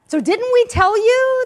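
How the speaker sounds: loud, high put-on accent of the complaining Israelites; mock accent. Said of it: "they said in the accent".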